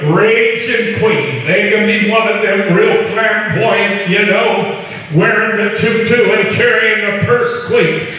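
fired-up pastor addressing his church; preaching. Real, not acted. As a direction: pitch it up and preach it to the congregation. Raised-pitch preaching run.

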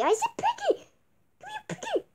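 put-on very high, whiny kid voice; a spoiled little kid demanding; high bratty child.

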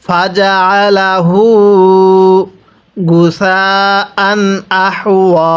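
slow drawn-out tajweed chant; Quran recitation. Shown as a demonstration. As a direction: stretch every vowel like a teacher reciting. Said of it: "কিভাবে পড়ব?".

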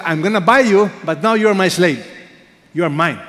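loud, hard claim of ownership; domineering master. Acted. Preacher voicing a Hosea who owns his wife, loud and harsh. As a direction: bark it like a slave owner.

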